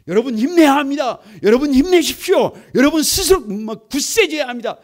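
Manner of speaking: mock cheering, everyone lifting each other up; mock cheerleading. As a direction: mimic people cheering others on. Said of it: "이렇게 하는 게 아니라".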